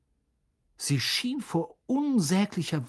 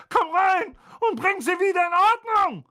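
angry yelling; 5 dB louder than usual. A narrator's reading. Vernon yelling.

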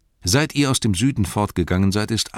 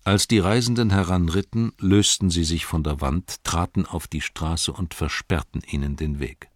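usual speaking voice

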